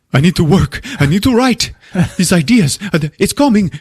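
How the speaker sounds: high, excited hammed-up urgency; mock inspired frenzy. Writer telling wife he must work, frenzied excitement. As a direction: cry out as if ideas are bursting.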